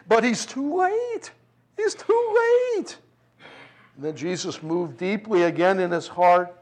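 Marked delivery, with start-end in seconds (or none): 0.1-1.3 sneering jeer pitched well up; mock him for being late; scornful mockery, high and slow. Mourners mocking Jesus, acted. 1.8-3.0 sing-song taunt, pitched way up; jeering taunt, very high. Acted. Mourners mocking Jesus, acted.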